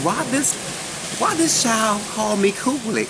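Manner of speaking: high-pitched, incredulous retold question; hammed-up bewilderment, pitched high; baffled question. Acting out her baffled question.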